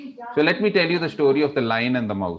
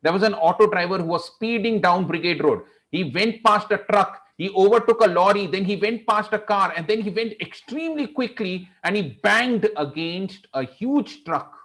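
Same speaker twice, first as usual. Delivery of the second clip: high-pitched, driving, thrilling narration; fast action telling; 6 semitones higher than usual. Shown as a demonstration. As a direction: race through the chase, landing on the crash. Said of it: "I'm gonna go fast".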